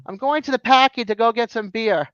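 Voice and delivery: lively Boston local, "packy" and "beer" with no R; Boston accent. Shown as a demonstration. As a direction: play a Boston guy heading to the packy. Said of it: "a liquor store is a packy".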